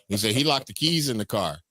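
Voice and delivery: ordinary talk, nothing marked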